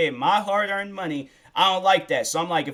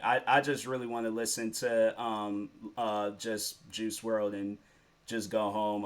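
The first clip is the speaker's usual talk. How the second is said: glum mumble; 5 semitones lower, 8 dB quieter than usual. Retold or imitated. Retold glum wish to leave.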